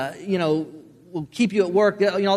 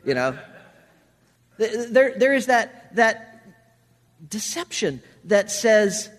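usual speaking voice

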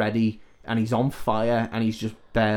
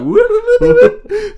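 mock slipping yelps; 13 dB louder than usual. Freddy slipping on marbles.

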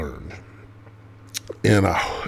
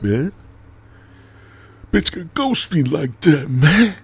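higher, louder incredulous rant; venting disbelief at being ghosted; exasperated inner rant; 8 semitones higher, 5 dB louder than usual. Retold or imitated. Talking to himself about the ghosting, exasperated. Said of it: "so I'm thinking".

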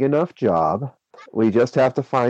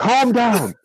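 higher, gentle calming voice; soothing; 12 semitones higher than usual. Acted. Toby calming hysterical Kimmy.

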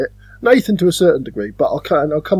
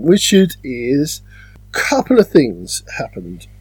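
ordinary talk

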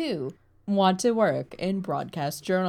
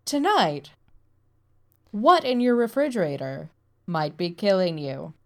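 dramatic TV news teaser; hammed-up newsreader hook; news anchor voice. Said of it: "I'm just mocking news anchor voices in general".

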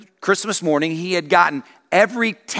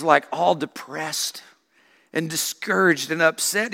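usual speaking voice

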